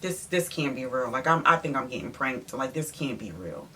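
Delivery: low, soft mutter of disbelief; retold quiet disbelief, pitched low; stunned disbelief. Teller voices her stunned reaction.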